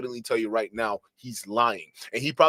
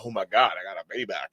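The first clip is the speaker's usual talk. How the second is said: mocking braggart; 7 semitones lower than usual.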